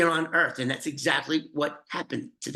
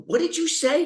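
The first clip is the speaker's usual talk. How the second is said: sharp, much higher disbelieving question; incredulous; 13 semitones higher, 3 dB louder than usual. Retold or imitated. Mad Dog hearing he must kill a kid, voiced in higher disbelief. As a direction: jump up in pitch in shock. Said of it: "He go".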